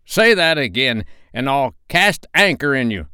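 high, loud pirate threat; blustering impostor captain; retorting threat. A narrator's reading. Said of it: "the voice retorted".

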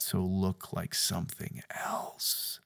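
hushed, slow whisper. Acted. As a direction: drop to a secretive whisper. Preacher whispers the inner voice.

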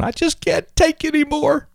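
high-pitched, hammed-up fed-up complaint; mock exasperation. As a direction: imitate someone at the end of their rope. Acts out an overwhelmed person's complaint.

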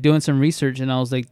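ordinary delivery

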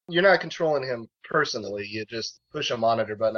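normal talking voice

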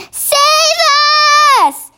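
high, drawn-out cry for help; giant's scream. A narrator's reading. Nightmare-struck giant begs to be saved, screaming. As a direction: scream it out in panic. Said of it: "screamed the flesh lump eater".

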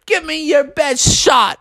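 high-pitched goading dare; cocky acted taunt. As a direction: goad the opponent in a put-on high voice. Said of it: "And he taunted Froggy".

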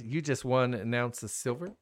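ordinary talk

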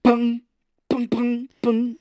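loud, high vocalised pungs; mouth-made ringing hits; imitated pung noise. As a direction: punch each pung like an instrument. Imitating sounds from film.

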